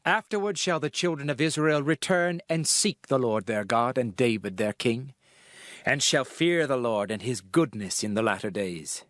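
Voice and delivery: ordinary delivery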